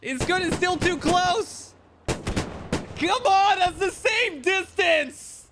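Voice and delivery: high, strained "come on" at the screen; exasperated outcry. Real, not acted. Caster frustrated at close rocket shot.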